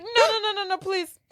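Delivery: high, rapid string of panicked refusals; mock pleading. Retold girl pleading in alarm.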